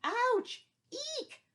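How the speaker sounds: very high, loud hammed-up squeal; big squeal of pain. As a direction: overact the goat's bitten yelp. Ant keeps biting goat.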